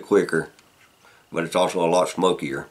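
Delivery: talking around pipe stem; pipe in mouth. Real, not acted. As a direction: lips gripping pipe. Seen 1: Pipe stem held in his lips throughout the labelled words.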